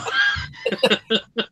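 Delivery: high shouted laugh; loud burst of laughter. Real, not acted. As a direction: laugh out loud at the dad's reaction. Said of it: "What the fuck is going on?".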